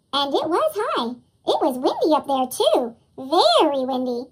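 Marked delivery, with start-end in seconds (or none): none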